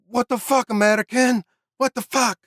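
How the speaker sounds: high-pitched, half-hearted Scottish yell; mock Scottish outburst at the American; attempted Scottish accent. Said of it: "he's like, in a Scottish accent, which I can't do".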